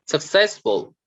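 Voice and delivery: "successful" with CESS pitched high; stress on middle syllable. Shown as a demonstration. Teacher reading out the middle column, middle stress on "successful". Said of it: "stress on the middle".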